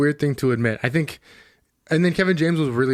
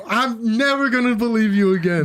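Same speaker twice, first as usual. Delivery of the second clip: higher, slower hurt protest; betrayed whine; 8 semitones higher than usual. Retold or imitated. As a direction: play the wounded, betrayed buddy. Betrayed friend protests at the bar.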